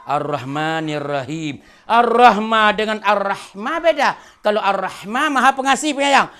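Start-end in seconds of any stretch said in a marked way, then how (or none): none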